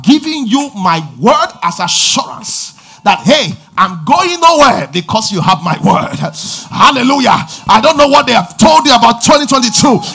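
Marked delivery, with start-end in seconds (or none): 3.2-10.0 loud, high, slow fervent preaching; fiery call and response sermon; preaching at full force. Acted. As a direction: drive the crowd with loud calls. Preaching climax.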